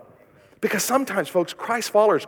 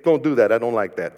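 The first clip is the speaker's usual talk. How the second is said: mock rebuke; 8 semitones lower than usual. Shown as a demonstration. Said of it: "Look at him and say".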